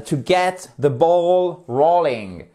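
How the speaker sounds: slow, careful English model; slow teacher repetition; slow model phrase. Shown as a demonstration. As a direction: repeat it slowly for students. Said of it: "littéralement vous lancez la balle".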